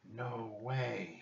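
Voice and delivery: soft, hushed disbelief; whispered. A narrator's reading. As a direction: say it under your breath, stunned. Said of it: "he whispered".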